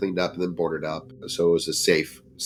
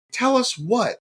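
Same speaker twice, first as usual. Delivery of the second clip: much higher, curious demand; eager demand. Retold or imitated. Friends press Gabe to tell them. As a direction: press for the secret.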